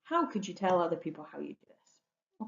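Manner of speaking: ordinary talk, nothing marked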